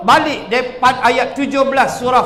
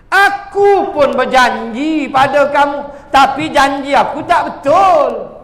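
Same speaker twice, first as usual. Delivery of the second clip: Satan's voice; 5 semitones higher, 4 dB louder than usual. Acted. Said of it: "Lalu setan kata lagi".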